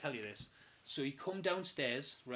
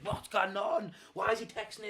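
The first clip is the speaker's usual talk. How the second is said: retold alarmed demand; 6 semitones higher than usual. Retold confrontation with flatmate.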